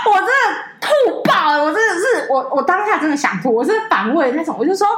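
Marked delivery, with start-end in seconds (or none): 0.0-2.6 squealing with laughter at the memory; laughing. Real, not acted. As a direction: laugh it out in disbelief. Laughing while telling disgust.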